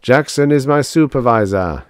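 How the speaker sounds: solemn chanted declaration from a robot; intoned. A narrator's reading. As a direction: intone it like a line of liturgy. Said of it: "intoned R A two oh four".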